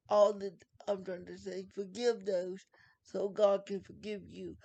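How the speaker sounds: usual speaking voice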